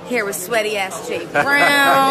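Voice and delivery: loud, slow hype host; live reporter. Acted. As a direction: announce it like live TV. Hosting live from backstage.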